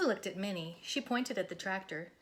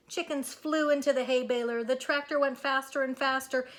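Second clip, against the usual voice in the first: louder and louder narration; building excitement; 5 semitones higher, 8 dB louder than usual. A narrator's reading. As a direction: build the ride to a roar.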